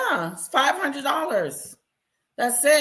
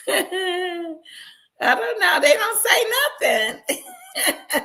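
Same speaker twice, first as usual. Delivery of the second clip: laughing; 8 semitones higher, slower than usual. Real, not acted. Laughs at own reply.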